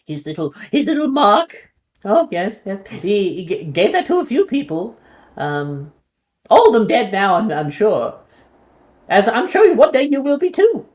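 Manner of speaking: lightly voiced prim old owl; a hint of a haughty old owl; fussy old owl. Owl talks of mark.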